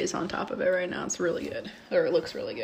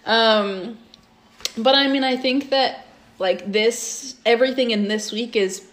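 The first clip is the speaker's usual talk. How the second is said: ordinary talk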